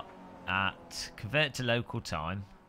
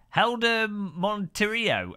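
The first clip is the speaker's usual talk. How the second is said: drawn-out name; 9 semitones higher, 3 dB louder, much slower than usual. Real, not acted. Reading viewer name drawn out.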